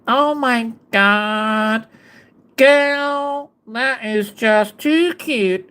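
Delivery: put-on pinched-nose Valley girl, slow and drawn out; nasal American Valley girl, pinching the nose; slow pinched-nose Valley girl gush.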